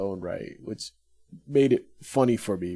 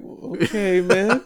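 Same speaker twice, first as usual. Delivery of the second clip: mock surprise; 9 semitones higher, 5 dB louder, much slower than usual. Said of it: "I'm like".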